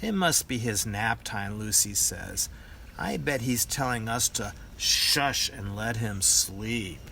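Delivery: plain reading voice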